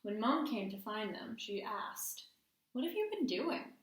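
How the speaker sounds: storytime read-aloud with hushed suspense; gentle picture-book narration; reading to children. A narrator's reading. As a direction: read it like bedtime story time for the class. Teacher reads story aloud.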